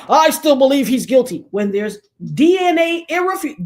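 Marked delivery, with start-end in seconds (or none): 0.1-1.5 mock stubborn detective, louder and higher; stubborn old detective. Old detective refusing to back down, mock stubborn insistence. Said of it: "old detectives still be like".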